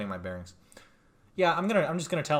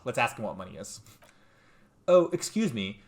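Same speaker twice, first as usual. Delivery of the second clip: normal talking voice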